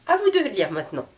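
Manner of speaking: usual speaking voice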